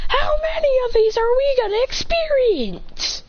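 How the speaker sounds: high-pitched acted outcry; exasperated wail. A narrator's reading. Exasperated at another attack.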